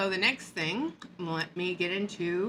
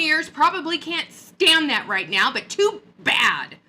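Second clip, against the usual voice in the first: angry rant; 6 dB louder than usual. Real, not acted. Ranting at MLM upline.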